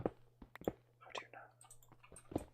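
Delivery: drawn-out whisper; spooky whisper. Acted. Streamer asking a viewer in mock fear, slow whisper. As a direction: breathe it slowly like a creepy secret. Viewer guessed door colour.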